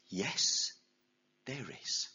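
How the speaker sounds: low, soft voice from the sky; calm powerful voice. Acted. God answers the man from the sky, calm low voice. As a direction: answer calmly from above. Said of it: "this calm, that very powerful voice".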